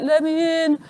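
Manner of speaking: put-on husband calling from outside the room, not in his accent; impersonated husband. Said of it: "that isn't how he talks, he's Australian".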